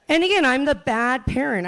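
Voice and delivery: ordinary talk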